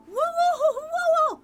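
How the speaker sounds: very high, loud amazed whoa; excited whoa. Real, not acted. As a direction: shout it out in amazement. Sudden discovery in game.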